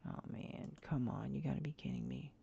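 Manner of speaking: real exasperated whisper; frustrated whisper. Real, not acted. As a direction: mutter it under the breath in defeat. Frustrated after losing photo.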